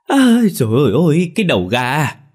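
high, loud, horrified shriek. A narrator's reading. Wife picks up a severed chicken head, horrified high scream. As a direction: scream as she sees what's in her hand. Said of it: "bà lập tức thét lên".

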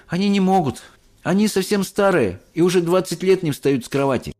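put-on pointing, eager boy; boy showing the way; lightly voiced boy. A narrator's reading. Charlie voice. (мальчик показывает)

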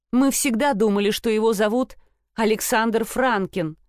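ordinary delivery

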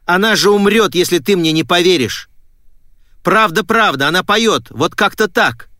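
high, loud, urgent insistence; desperate pleading. A narrator's reading. Girl begging her father to believe her, desperate. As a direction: beg to be believed.

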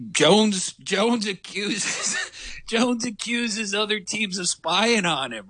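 reading the Australian coach headline high, laughing in disbelief; can barely get the headline out for laughing; laughing through the headline. Real, not acted.

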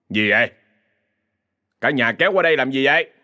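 mildly annoyed, curt questioning; a touch of irritation in the question; irritated. A narrator's reading. Gruff man questions neighbours who came over, irritated. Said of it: "giọng đầy khó chịu".

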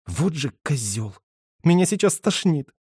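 pinched-nose whine; whiny nasal voice. Acted. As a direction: whine through a held nose. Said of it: "проныла Ира". (гнусавое нытьё)

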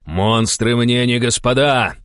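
slow, loud, high defiant shout; barked retort. A narrator's reading. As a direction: bark it out in defiance. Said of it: "рявкнул Денис". (рявкнуть с вызовом)

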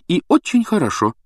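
high, animated approval; perked up. A narrator's reading. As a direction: brighten up with sudden relief. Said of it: "оживилась миссис Уизли".

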